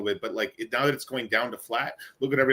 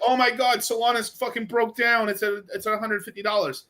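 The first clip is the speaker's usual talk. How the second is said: mock panic; 10 semitones higher than usual. Mocking panicked holders on the chart, high and frantic.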